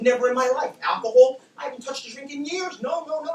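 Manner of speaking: loud, high-pitched retold protest; exasperated denial. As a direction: shout back the denials, fed up with being asked. Retold exasperated answers to repeated questions.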